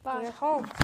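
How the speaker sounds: normal talking voice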